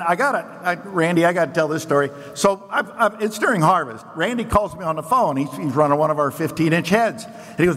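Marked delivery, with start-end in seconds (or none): none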